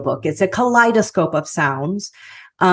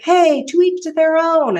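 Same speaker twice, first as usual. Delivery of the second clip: high breezy; 12 semitones higher than usual. Retold or imitated. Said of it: "'cause I was like".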